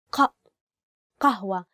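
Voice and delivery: قهوة with a hard qaf from the back of the throat; qaf pronunciation. Shown as a demonstration. Teacher demonstrating the standard Arabic qaf. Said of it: "with the letter قاف".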